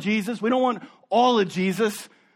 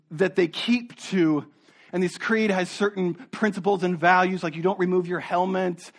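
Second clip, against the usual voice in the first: ordinary talk, nothing marked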